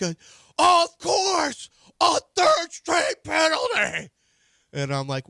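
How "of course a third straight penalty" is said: very high, loud wailing yell, slow; imitating son screaming. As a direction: howl it like a despairing young fan. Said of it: "he's screaming".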